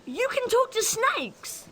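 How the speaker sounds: high, drawn-out disbelief; incredulous, slow and high; astonished. Acted. Astonished at friend's power.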